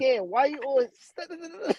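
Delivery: high, mocking stutter of a small child; child's taunting stutter; teasing little girl. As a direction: tease him like a bratty little sister. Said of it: "she'll go behind him and tease him".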